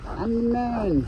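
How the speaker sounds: high squeaky boast; high-pitched. Pretend. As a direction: jump way up high. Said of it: "repeated higher".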